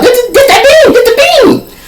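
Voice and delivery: very high, crying-laughing squeal; exaggerated mock whimper; whiny squeal. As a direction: squeal it like he's about to cry. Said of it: "That's how it sounded like".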